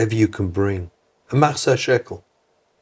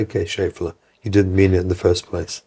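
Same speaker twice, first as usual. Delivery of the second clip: gentle forgiveness; 3 semitones lower than usual. Retold or imitated. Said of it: "when you do it the other way".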